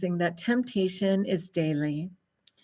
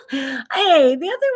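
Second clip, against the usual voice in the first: laughing; 6 semitones higher, 7 dB louder, slower than usual. Real, not acted. Laughing at non-audience.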